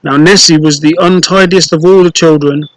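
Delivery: ordinary delivery, nothing marked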